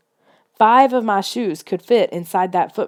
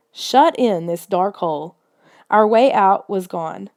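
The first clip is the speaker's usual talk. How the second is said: ordinary delivery, nothing marked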